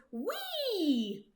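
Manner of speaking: high, light whistle sound; whistled wee. Acted. Woodchuck whistles friendly.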